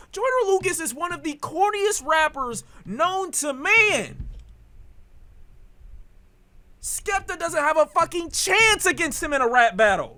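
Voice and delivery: high-pitched, heated dismissal; real worked-up rant. Real, not acted. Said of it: "it just be stirring me up".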